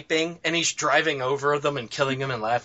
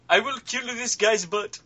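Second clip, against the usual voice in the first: higher-pitched mock threat in an Indian accent; imitated Indian accent; 6 semitones higher, 4 dB quieter than usual. Repeating a gamer's threat for laughs, imitated Indian accent. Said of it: "Screaming in an Indian accent".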